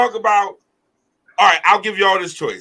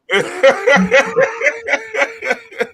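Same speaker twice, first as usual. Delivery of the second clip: high-pitched cackling laugh; big laugh; 4 dB louder than usual. Real, not acted. Host reacting to a co-host's joke, high helpless laughter. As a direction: crack up in a long high cackle.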